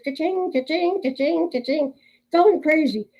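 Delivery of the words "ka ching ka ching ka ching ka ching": sing-song ka-ching of busy registers; imitated cash register. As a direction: ring it out like a till, over and over. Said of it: "the registers".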